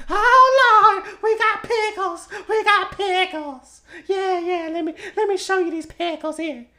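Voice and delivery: very high, loud hammed-up old church lady; Deary B impression. Podcast host performing Deary B's sales pitch, very high hammed-up voice. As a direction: belt it out like an over-eager pickle seller. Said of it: "I'm gonna push my microphone back 'cause I'm gonna do it".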